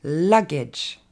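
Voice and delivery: LUGGAGE as "LUG-edge", LUG punched; correct English pronunciation. Shown as a demonstration. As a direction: stress LUG, short falling "edge" in LUGGAGE. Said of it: "Donc ça se dit".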